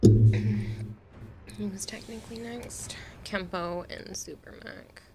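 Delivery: slow whisper to self. Real, not acted. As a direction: whisper it while checking. Whispers while checking queue.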